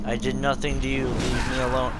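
usual speaking voice

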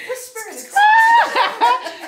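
loud, slow startled shout. Retold or imitated. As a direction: bellow it out slowly. Retold reaction to a noise behind.

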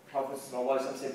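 ordinary talk

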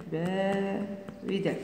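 "باردا" slow and soft, split into syllables; slow dictation. Shown as a demonstration. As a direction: stretch "با", pause, then quietly finish "ردا".

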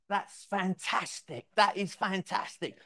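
a client overjoyed at his new brand; thrilled. Retold or imitated. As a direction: let the delight stretch out. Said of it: "He went absolutely bonkers".

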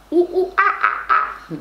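high monkey hoots trailing into breathy huffs; monkey noises. Shown as a demonstration. Teacher joking about the animal meaning of mono with monkey hoots. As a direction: hoot like a monkey, then huff. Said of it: "No, no es ese mono".

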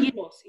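gyros said YEE-ros; Greek pronunciation. Shown as a demonstration. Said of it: "or however you pronounce it".

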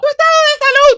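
very high, loud scolding question; exasperated demand. Acted. Mother correcting the drunk's answer, exasperated. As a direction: shout the correction impatiently.

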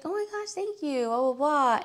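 high, bubbly imitation of a gushing thank-you. Founder thanking a fan who loves her brand, high and gushing. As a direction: squeal the thanks, then toss off the rest.

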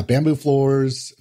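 normal talking voice

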